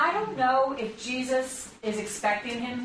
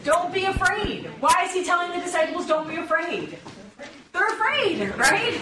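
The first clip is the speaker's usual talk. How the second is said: higher, louder and building; animated emphasis; 7 semitones higher, 4 dB louder than usual. Real, not acted. Preacher urging the congregation, excited and louder. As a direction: drive the point home with rising energy.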